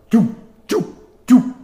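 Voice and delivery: high, loud "doo" bursts like a nail gun; short high mouth-made gun pops; imitated nail gun. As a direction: mimic a pneumatic nail gun firing, punched and spaced. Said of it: "you may have heard it, goes".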